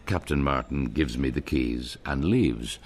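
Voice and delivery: ordinary talk, nothing marked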